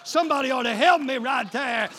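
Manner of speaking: usual speaking voice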